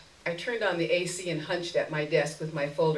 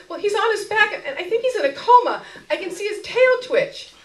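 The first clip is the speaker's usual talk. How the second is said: high, shrill, choking back tears; shrill, near tears; 8 dB louder than usual. Acted. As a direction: plead through muffled tears. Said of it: "choked by muffled tears".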